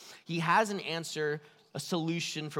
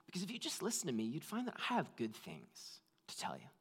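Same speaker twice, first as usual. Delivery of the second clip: much softer, tender and inviting; gentle voice of God; 9 dB quieter, faster than usual. Shown as a demonstration. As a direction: speak as a loving God calling. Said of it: "the voice of God saying".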